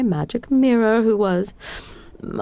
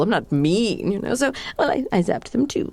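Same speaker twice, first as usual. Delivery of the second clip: normal talking voice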